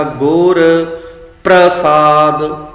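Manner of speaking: ਪ੍ਰਸਾਦ said slowly with a half r after the ਪ; half-r pronunciation. Shown as a demonstration. Teacher showing the congregation the right reading, ਪ੍ਰਸਾਦ with half r. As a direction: draw out ਗੁਰ ਪ੍ਰਸਾਦ with a light, joined r. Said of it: "ਇੱਥੇ ਉਚਾਰਨ ਅੱਧੇ ਰਾਰੇ ਦਾ ਇਸ ਤਰ੍ਹਾਂ".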